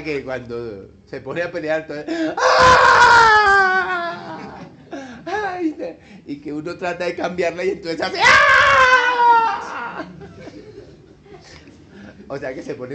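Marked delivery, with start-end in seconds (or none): 1.9-4.6 drawn-out high ambulance wail; siren wail. Retold or imitated. Imitating the ambulance fighter's wailing scream. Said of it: "es la peleadora ambulancia". 8.2-10.0 short high wailing scream; siren scream. Retold or imitated. Imitating the ambulance fighter's wailing scream. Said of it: "es la peleadora ambulancia".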